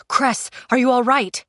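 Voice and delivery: worried and pressing; urgent concern. A narrator's reading. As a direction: ask it urgently, pushing the voice a little. Thorn checks on her after gunshot.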